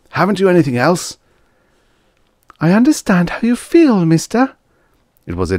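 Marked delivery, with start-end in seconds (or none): 0.1-1.2 quick, high-pitched irritated demand; barked. A narrator's reading. Diner complaining into the table phone, barked. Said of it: "he barked into the table phone". 2.6-4.5 put-on high-pitched girl on the phone; young woman's voice. A narrator's reading. Waitress answers an unhappy diner by phone, female voice. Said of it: "female voice that answered".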